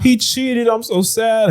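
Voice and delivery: high, whiny mock sob; mock crying woman. A woman crying on a live stream, mock high sobbing voice. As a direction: play it as a fake tearful breakup post. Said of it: "and she's crying".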